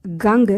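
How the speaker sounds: गंग with the nasal ng sounded through the nose; teacher modelling गंग, nasal ng then ग; Sinhala ganga. Shown as a demonstration. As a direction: say गंग as gang-ga, nasal half n before ग. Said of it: "गंग, बोलिए मेरे साथ".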